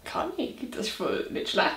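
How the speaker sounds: soft unvoiced whisper. Real, not acted. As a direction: whisper it aside. Unvoiced aside about grade.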